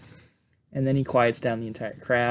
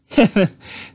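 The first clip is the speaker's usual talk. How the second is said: amused laugh; 8 semitones higher, 5 dB louder than usual. Real, not acted. Laughing at own impression.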